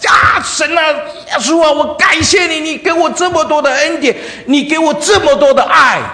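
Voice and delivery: high, loud, wailing thanks to God; crying loud prayer. Retold or imitated. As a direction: cry out to Jesus through tears. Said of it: "他开始哭了".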